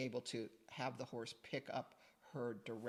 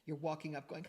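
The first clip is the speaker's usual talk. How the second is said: ordinary delivery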